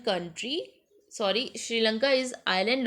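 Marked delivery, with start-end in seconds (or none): none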